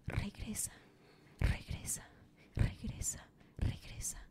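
breathy repeated whisper, spaced out; ghostly whisper. Acted. La señora decapitada le susurra. (susurro fantasmal repetido)

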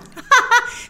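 laugh. Real, not acted. Laughs at own joke.

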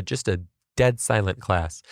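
ordinary talk

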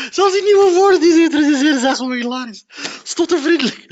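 loud, tearful laughing speech; laughing so hard it sounds like crying; crying with laughter. Real, not acted. Vlogger in tears over the new words, laughing. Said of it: "omdat ik tranen weg te vegen".

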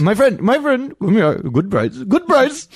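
high, exaggerated Indian-accented hawker; comic Indian vendor voice; mock Indian accent. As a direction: pitch it way up in a put-on Indian accent. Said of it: "Can you say, "My friend".

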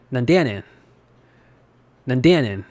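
Kansai dialect with the tune falling from "ya"; Kansai intonation. Shown as a demonstration. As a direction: say "Nande ya nen?" with Osaka lilt. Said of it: "You might notice the difference in intonation".

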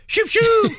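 loud, very high sound effect; high whoosh noises. Shown as a demonstration. Father trying sound effects on his son, loud high whooshes. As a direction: shoot two piercing zooming noises out. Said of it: "Maybe sound effects".

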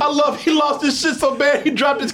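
can't stop laughing. Real, not acted. Laughing about the scene.

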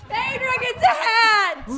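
high, loud, slow proclamation; mock fanfare announcement. Host crowning the winner with a hat, hammed-up announcement. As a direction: announce the prize like a game-show host.

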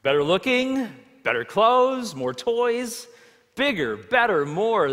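preacher mimicking worldly greed; mock-excited listing. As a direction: hammer out the world's cravings. Preacher mocks the world's values.

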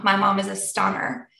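usual speaking voice

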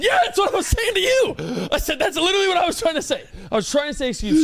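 high, fast sarcastic comeback; exasperated retort. Retold or imitated. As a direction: fire back, fed up and mocking. Retold comeback to the woman.

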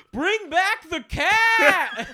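high, slow laughing yell; fan yelling. Retold or imitated. Fan in the crowd yelling at the ring, high and laughing. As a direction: bellow it from the back of the crowd. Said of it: "Some guy in the back goes".

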